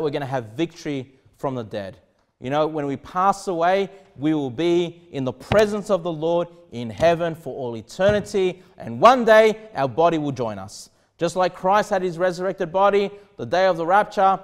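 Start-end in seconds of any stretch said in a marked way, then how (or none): none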